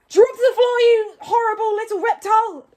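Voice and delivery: furious high, booming order; very loud outraged bellow; Stoic yelling. A narrator's reading. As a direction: roar the command at full force. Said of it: "yelled Stoic".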